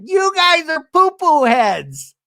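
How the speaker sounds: put-on high, slow little-boy voice; Ben as little kid. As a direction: scold the grown-ups like a small child. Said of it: "Ben, to come out and be like".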